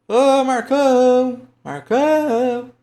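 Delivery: high, loud, singsong name call; long drawn-out shout for someone. Shown as a demonstration. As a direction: holler from the gate, holding the last syllable. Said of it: "do mesmo jeito que você chama".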